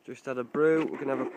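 ordinary talk

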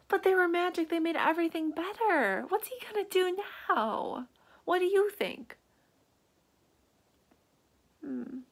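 usual speaking voice

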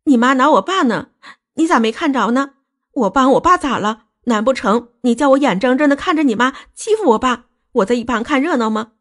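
high-pitched, aggrieved protest; indignant wailing. Acted. Wife defending her bleeding father to husband, indignantly crying out. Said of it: "就气愤地哭喊说".

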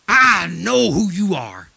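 loud, slow demonic voice, imitated; menacing demon imitation; possessed girl. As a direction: draw it out like a demon confronting someone. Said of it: "she started manifesting".

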